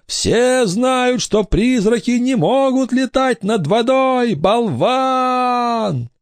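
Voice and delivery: high-pitched wailed retort; ghostly howling. A narrator's reading. Boy ghost scolds doubting pirate, high howling voice. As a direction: wail the words like a ghost, stretching the last. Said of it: "прервала вой брата".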